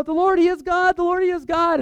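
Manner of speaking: high, excited mock chanting; acted crowd acclamation. Everybody hails God after the fire falls, excited acted chant.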